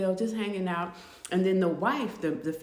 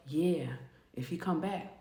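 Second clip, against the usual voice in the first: low, soft musing; lower, softer thought to herself; quiet inner resolve; 5 semitones lower, 7 dB quieter than usual. Retold or imitated. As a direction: act out a private warning under her voice. Inmate's private warning to herself.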